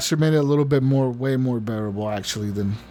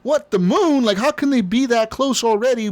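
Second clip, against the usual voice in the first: much higher, mock shocked disbelief; retold disbelief; 8 semitones higher than usual. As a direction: play the stunned commander, pitch jumping up. Commanders shocked moon is lost.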